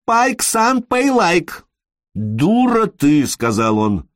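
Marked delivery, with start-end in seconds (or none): 0.1-1.6 very high, relentless cry for money; stubborn high-pitched pestering call; screeched demand. Acted. Woman keeps crying after him for money. 2.1-3.3 drawn-out, higher-pitched insult; fed-up, slow-stretched rebuke; exasperated insult. Acted. Exasperated man insults her.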